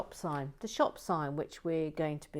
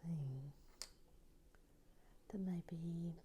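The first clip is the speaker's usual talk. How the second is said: whispering; 13 dB quieter, much slower than usual. Real, not acted. Muttering to herself while building.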